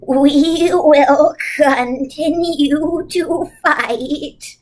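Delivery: very slow, determined vow; slow, resolute witch. A narrator's reading. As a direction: promise the children the fight will go on. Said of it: "Something in Missus Witch's voice".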